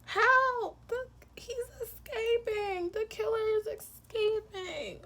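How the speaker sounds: very high, squealed fake panic; hammed-up alarm in a tiny high voice, starting loud; mock cry for help. Pretending to viewers that the killer is escaping, mock panic.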